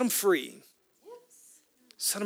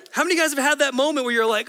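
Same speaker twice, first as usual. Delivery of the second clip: higher, louder, quicker; building excitement from the pulpit; excited; 8 semitones higher, 7 dB louder, faster than usual. Real, not acted. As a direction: rush the question, fired up. Preacher building up.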